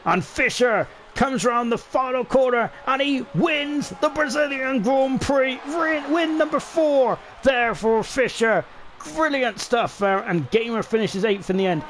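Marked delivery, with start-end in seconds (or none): 0.0-10.2 high, slow, shouted race-win call; excited finish call. Real, not acted. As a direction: call the winner across the line at full voice.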